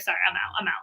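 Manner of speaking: soft breathy whisper, backing away; whispered brush-off. Retold or imitated. Viewer leaving a dull live video, whispering an exit. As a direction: slip out quietly, murmuring sorry. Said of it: "And it's like".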